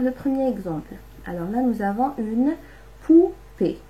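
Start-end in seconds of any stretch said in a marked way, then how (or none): 3.0-3.8 "poupée" in two beats, high loud "pou", pause, "pée"; split into syllables. Shown as a demonstration. Teacher showing children the word poupée, split into syllables. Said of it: "compter combien ça contient de syllabes".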